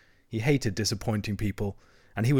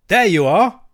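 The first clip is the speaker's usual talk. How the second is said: high, loud proud shout; triumphant cry; 9 semitones higher, 10 dB louder than usual. A narrator's reading. Toad unveiling the caravan to friends, crying out. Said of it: "cried the toad".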